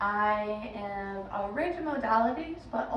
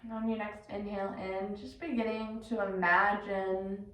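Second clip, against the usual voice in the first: measured meditation guide, long pauses between phrases; guided meditation. Acted. Yoga teacher guiding listeners into breath and body, guided meditation. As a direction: lead them inward unhurriedly, letting each instruction settle.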